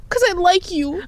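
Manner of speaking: high, mock-tearful confession; lovesick kid. Kid confessing to friend's sibling, mock-tearful. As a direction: whine it like a smitten child.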